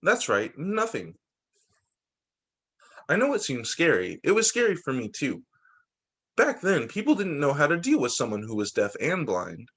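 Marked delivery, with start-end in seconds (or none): none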